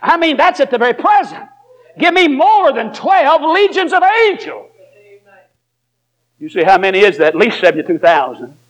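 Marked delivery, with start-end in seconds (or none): 0.0-4.7 high, slow preacher's build; preaching. Acted. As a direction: preach the verse out slowly. Preaching at full force.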